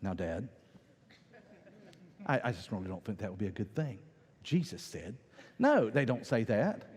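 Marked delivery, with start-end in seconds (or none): 0.0-5.2 prim mock child. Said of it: "The three-year-old doesn't go".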